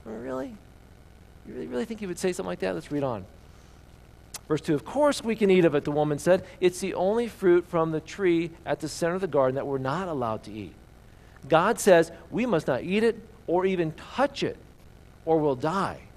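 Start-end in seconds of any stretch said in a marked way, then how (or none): 0.0-2.7 lightly voiced doubting serpent, higher and fast; a hint of a sneering tempter planting doubt; sly tempter. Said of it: "He questions God's motives". 4.7-16.0 reading the Bible passage aloud to the congregation; reading scripture to the church. Acted.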